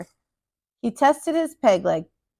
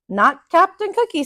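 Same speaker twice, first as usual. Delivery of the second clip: put-on child's protest; 7 semitones higher, 4 dB louder than usual. Jim protests to mother.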